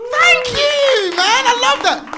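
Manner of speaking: loud, high hype to the crowd; big excited MC thanks; hyped thanks. Real, not acted. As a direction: pump up the room with thanks. MC hyping the crowd.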